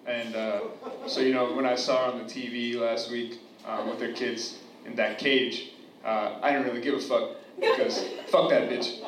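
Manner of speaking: normal talking voice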